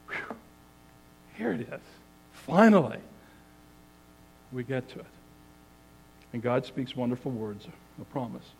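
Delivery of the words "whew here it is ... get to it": collective relief. Retold or imitated. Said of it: "we let out this collective".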